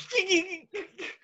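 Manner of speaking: high, squeaky suppressed giggle; imitated stifled laugh. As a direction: act out trying to hold in laughter. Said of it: "And I was like".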